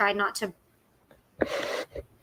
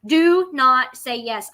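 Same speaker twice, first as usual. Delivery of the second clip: slow, firm "Do not say yes"; slow emphatic warning; stress on DO NOT; 4 dB louder, much slower than usual. Real, not acted. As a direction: lean on each word as a warning. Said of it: "warning viewers".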